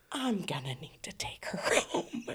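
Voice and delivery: whispered aside, half laughing; hushed whisper. Retold or imitated. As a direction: lean in and whisper it with a giggle. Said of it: "she's like".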